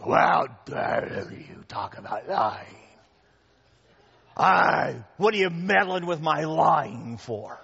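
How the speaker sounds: hammed-up offended complaint, slow and loud; mock indignant churchgoer. Pastor mimics an offended listener, mock outrage. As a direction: play the offended congregant, drawn out. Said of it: "no one goes".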